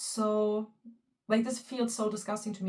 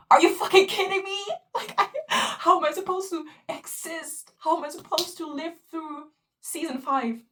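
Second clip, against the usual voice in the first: outraged disbelief; 7 semitones higher, 7 dB louder than usual. Real, not acted. Outraged reaction to the scene.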